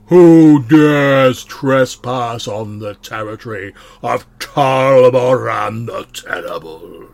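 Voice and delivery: huge, slow, booming demonic voice. Acted. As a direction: boom it out like a fire demon guarding its land. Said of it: "a loud voice booms out and says".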